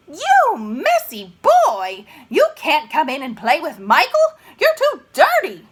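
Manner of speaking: loud, high scolding yell; scolding mother. Acted. Mother yelling at her dirty son at the door, hammed-up scolding. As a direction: scold the messy boy at the door.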